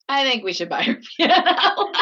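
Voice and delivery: words breaking into laughter; laughing through it. Retold or imitated. Mom's funny remark, laughing.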